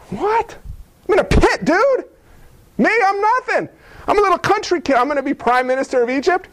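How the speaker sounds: high, disbelieving protest; can't believe the news; surprised boy in the pit; incredulous. Acted. Joseph disbelieving.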